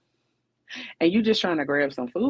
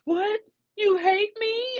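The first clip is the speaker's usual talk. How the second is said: much higher, slow wounded whine; hammed-up high whiny man; mock hurt guy; slower than usual. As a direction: play the man who takes a no as hate. Mocking a rejected guy.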